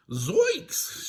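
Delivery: high cartoon yelp; Shaggy exclaiming. Shaggy hearing he is the bait, high startled exclamation. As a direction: exclaim in goofy alarm, pitch jumping up. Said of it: "Shaggy exclaimed".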